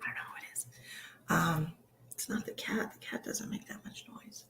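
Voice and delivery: hushed unvoiced aside; whisper. Real, not acted. Medium whispering an aside to viewers, hushed. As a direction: whisper it so nobody hears.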